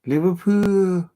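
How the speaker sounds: high, loud, drawn-out Scouse; attempted Scouse accent. Shown as a demonstration. Fan practising how to say Liverpool, exaggerated Scouse accent. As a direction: stretch it out in a hammed-up Scouse accent. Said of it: "a Scouser's accent".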